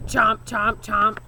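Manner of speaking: loud mock chomping; chomping sounds. A narrator's reading. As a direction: chew the words out noisily. Todd chewing loudly.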